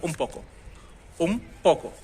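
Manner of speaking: उन said as um, lips closing into पोको; n turns to m before p. Shown as a demonstration. Teacher shows the native Spanish way, n becomes m. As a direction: blend उन पोको into um poko. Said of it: "मैं क्या बोल रहा हूँ".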